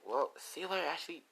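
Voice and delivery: put-on high, light girl's goodbye; softly acted high-pitched farewell; Lisa. Lisa says goodbye to friend.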